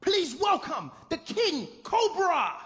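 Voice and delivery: high, building ringmaster introduction; imitating a rousing MC; excited show announcer. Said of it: "And he's like".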